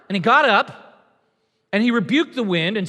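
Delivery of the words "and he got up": normal talking voice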